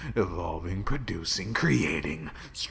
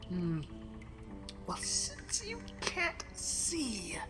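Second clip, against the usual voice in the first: mocking whisper; 9 dB quieter, slower than usual.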